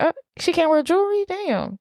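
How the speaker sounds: high, fast sarcastic quip; tossing back a scoff at the rude comment; sarcastic retort. Retold or imitated. Sarcastic retort to the rude partygoer, fast and high. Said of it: "I was like".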